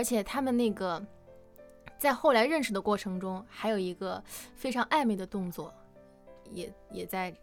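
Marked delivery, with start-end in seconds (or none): none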